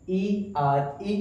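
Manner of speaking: ई आर ई spelled letter by letter, very slowly; slow spelling. Shown as a demonstration. Teacher spelling the ending of there for the class, slow letter by letter. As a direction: draw out each letter of ई आर ई. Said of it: "there में क्या आया है".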